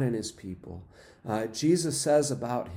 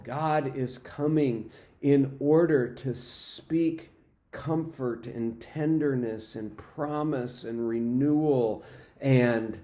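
ordinary talk, nothing marked

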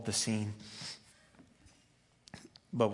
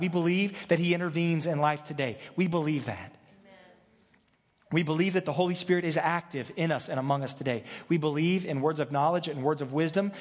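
rising, louder and higher preaching; preaching at full force; 5 semitones higher, 4 dB louder than usual. Acted. Pastor declaring the church's beliefs to the congregation, preaching loud and high.

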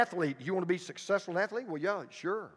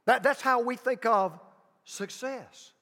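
usual speaking voice

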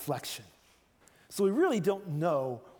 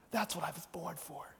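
calm and sincere; 3 semitones lower than usual. Shown as a demonstration. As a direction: tell it gently and humbly. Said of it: "I think Jesus was saying".

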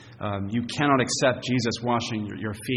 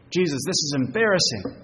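higher-pitched, insistent objection; offended Peter; 6 semitones higher, 4 dB louder than usual. Acted. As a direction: plead indignantly with a leader who embarrasses you. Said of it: "He seems offended".

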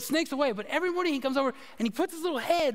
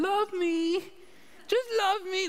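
higher, her own plain voice pleading; Jackie's own voice; 10 semitones higher, slower than usual. Retold or imitated. Jackie voicing the dog to her husband, in her own voice. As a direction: say it straight as Jackie would. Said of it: "She says it in her own voice".

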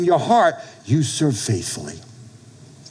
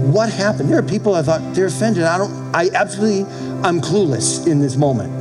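ordinary talk, nothing marked

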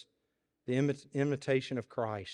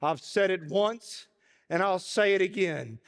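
gather strength to declare it; old preacher rising to declare; rising proclamation; 7 semitones higher, 4 dB louder than usual. A narrator's reading. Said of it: "in the loudest voice possible".

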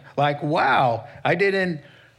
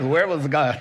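a far-off, laughing take on the devil's taunt; laughing mock devil; 3 dB louder than usual. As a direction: toss the question back with a laugh, hammed-up. Repeats the devil's question, laughing.

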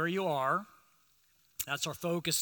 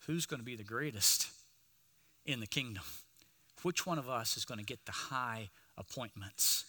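ordinary talk

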